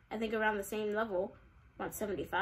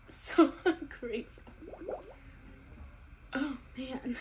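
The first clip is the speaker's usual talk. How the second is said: laughing; 2 semitones higher, slower than usual. Real, not acted. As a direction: lose it halfway through the word. Repeats it cracking up.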